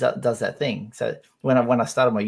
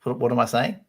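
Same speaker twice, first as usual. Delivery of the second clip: ordinary talk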